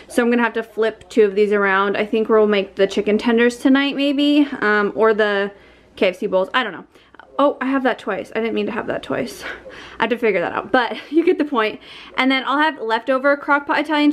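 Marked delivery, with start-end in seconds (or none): none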